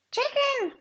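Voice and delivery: high, loud sing-out to a pet; calling out. Real, not acted. Calls out to a pet.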